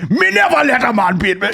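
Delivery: hammed-up Jamaican patois boast; Jamaican accent. Imitating the boxer's Jamaican switch, mock Jamaican accent. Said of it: "launched into the Jamaican".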